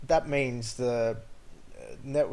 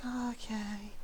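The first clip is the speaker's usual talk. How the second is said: much higher, put-on apologetic voice; apologetic driver; 10 semitones higher, 8 dB quieter, slower than usual. Driver apologising after rear-ending him, high put-on voice. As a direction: gush an apology. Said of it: "He's just like".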